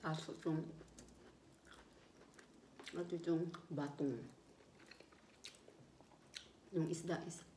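talking between bites; eating while speaking; mouth full, chewing. Real, not acted. Seen 3: Food put in mouth at -1; jaw and lips chewing throughout.

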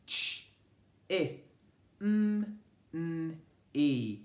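Ch- i m- n- e, each sound said alone and clipped apart; a phonics teacher segmenting for children; segmenting phonemes. Shown as a demonstration. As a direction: sound out "Chimney" one phoneme at a time. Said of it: "Have a think how many phonemes".

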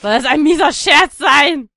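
loud, slow shout of outrage; real annoyed shout; indignant outburst. Real, not acted. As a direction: yell at the game for the cheap trick. Level trolls him.

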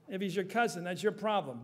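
ordinary talk, nothing marked

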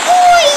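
sharp high yelp of pain. Real, not acted. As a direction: yelp out suddenly. Sudden pain in game.

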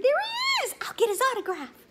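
very high, loud squealing fan; girl fan rushing to the swan, high squealing excitement; excited girl. Acted. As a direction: gush like a starstruck fan. Girl fan keeps screaming.

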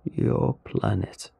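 very slow, deliberate alien; grinning alien. Acted. Alien reveals its story to the humans, slow and deliberate. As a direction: draw out each phrase like a storytelling alien.

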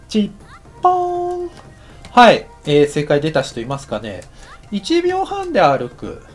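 ordinary talk

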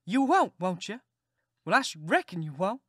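high sharp scoff; mock his promise with scorn; scornful aunt. Aunt scoffing at the boy's promise, high.

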